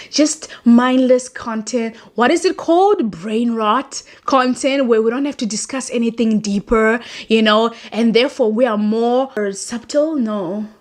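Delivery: usual speaking voice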